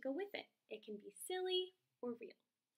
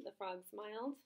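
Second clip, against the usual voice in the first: ordinary delivery, nothing marked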